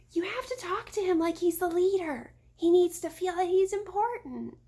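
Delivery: little sister coaching in a light voice; little girl voice. A narrator's reading. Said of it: "Daphne whispered".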